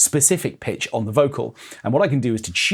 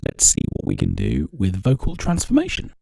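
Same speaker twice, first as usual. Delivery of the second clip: pitched-down processed voice; pitch shifted voice; 7 semitones lower than usual. Shown as a demonstration. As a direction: voice shifted lower. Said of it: "that's pitch change".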